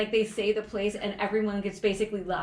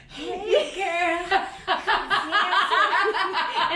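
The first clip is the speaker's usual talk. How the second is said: high, slow, hammed-up smooth talker; teasing mock flirt; 8 semitones higher, 6 dB louder, much slower than usual.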